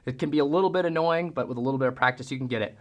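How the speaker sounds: normal talking voice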